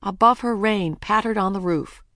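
ordinary delivery, nothing marked

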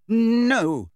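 high, loud, angry refusal; drawn-out indignant no; quivering with anger. A narrator's reading. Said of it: "her voice quivering with anger".

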